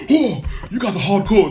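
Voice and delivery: high, loud mock-impressed exclamation; a hammed-up impressed guy, pitched high and loud; mock impressed waiter. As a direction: ham up the waiter's fake awe. Waiter voiced in retold scene.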